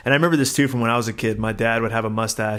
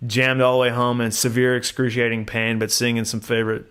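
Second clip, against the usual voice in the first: ordinary talk, nothing marked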